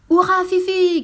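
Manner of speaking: hourra éclatant, bien plus haut et fort; cri de joie. Acted. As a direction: acclamez Fifi de toutes vos forces. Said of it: "crièrent Tommy et Annika". (les enfants acclament Fifi, cri de joie)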